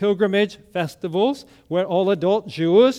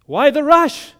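higher, louder rhetorical question; mock protest; 9 semitones higher, 8 dB louder than usual. Preacher voicing an objection to hurry, incredulous. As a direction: push the question out loud and high.

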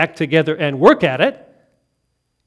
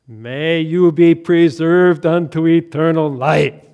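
liturgical blessing; 3 semitones lower, 6 dB louder than usual. Retold or imitated. Orthodox baptism blessing quoted.

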